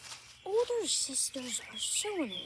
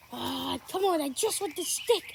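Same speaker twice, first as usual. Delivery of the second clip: loud; 7 dB louder than usual. Pretend. Pleading loudly for stick.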